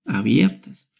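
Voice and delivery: ordinary talk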